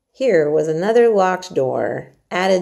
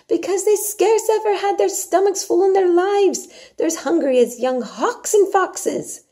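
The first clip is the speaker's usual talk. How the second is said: Yorkshire maid Martha; 13 semitones higher, 4 dB louder than usual. Acted. Martha urges Mary to eat her porridge, hearty and coaxing. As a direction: coax the child like a bossy country servant.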